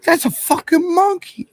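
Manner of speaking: high, slow squeal of disbelief; shocked disbelief. Retold or imitated. Friend retelling seeing a monkey, squealing in disbelief. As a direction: stretch it out, stunned and high. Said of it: "and I'm like".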